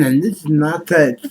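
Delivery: talking with tongue stuck out. Shown as a demonstration. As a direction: say it with your tongue out. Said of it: "talking with my tongue out".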